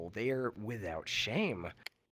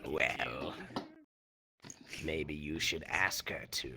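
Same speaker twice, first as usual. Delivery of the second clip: lightly voiced sly Sith lord; 10 semitones lower, 4 dB quieter, slower than usual. Sidious voice in the skit.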